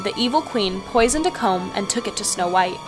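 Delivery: ordinary delivery, nothing marked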